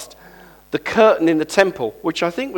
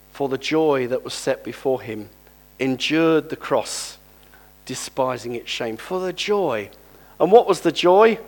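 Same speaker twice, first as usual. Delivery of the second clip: usual speaking voice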